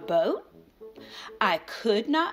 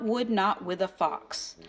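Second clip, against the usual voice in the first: ordinary delivery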